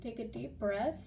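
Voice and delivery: choked up, pushing on through tears; holding back tears. Real, not acted. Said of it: "my tears".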